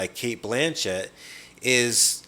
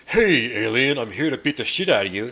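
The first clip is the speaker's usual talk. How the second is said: louder, brash hero taunt; mock tough action hero; 2 semitones higher, 5 dB louder than usual. Mocking a cocky heroine taunting the alien, louder. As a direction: swagger it like a dumb action hero. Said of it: "walk around and be like".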